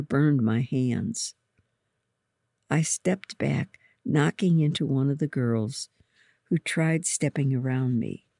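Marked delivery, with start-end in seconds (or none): none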